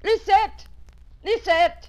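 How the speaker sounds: high, loud call for the maid. Acted. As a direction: call her in from the next room. Calling maid from another room.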